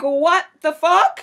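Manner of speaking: high, loud and slow "what the fuck"; loud, drawn-out shock; outraged disbelief. Retold or imitated. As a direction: stretch it out in stunned outrage. Outraged reaction to being called emotional.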